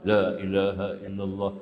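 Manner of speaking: "La ilaha illallah" said with the mouth barely opening, all in the throat and tongue; lips closed. Shown as a demonstration. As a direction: keep the lips still through "La ilaha illallah". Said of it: "Tak payah buka mulut".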